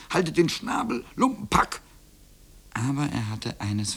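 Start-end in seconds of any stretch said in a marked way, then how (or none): 0.1-1.8 higher, squeakier exasperated outburst; annoyed call. A narrator's reading. Boy telling the hens off, crying out crossly. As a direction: snap back at the taunting birds. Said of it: "warf einen Stein nach ihnen und rief".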